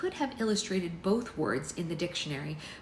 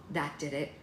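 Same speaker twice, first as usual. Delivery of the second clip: usual speaking voice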